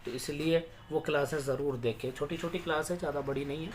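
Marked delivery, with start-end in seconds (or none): none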